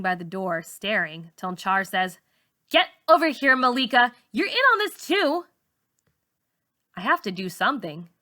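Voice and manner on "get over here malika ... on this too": loud, higher bullying call across the room; bossy shout. A narrator's reading. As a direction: yell at her to get over here. Char bossing Malika around.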